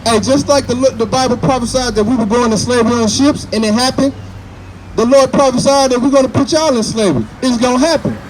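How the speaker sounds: loud, high street-preacher delivery; preaching. Real, not acted. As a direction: preach it out to the crowd.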